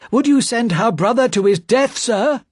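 high, loud pleading; desperate plea. Acted. Spy begs for his life.

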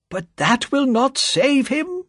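put-on high, slow sad old man's cry; a slow, high plea of sorrow from an old friend. A narrator's reading. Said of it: "cried Mister Lorry sadly".

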